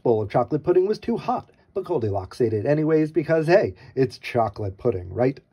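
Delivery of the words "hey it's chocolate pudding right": ordinary delivery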